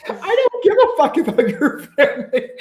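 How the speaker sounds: high-pitched, giggling replay of the line; laughing through the quote. Retold or imitated. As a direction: quote it while cracking up, voice jumping up. Repeating Ron's line, laughing.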